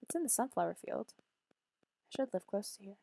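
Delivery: normal talking voice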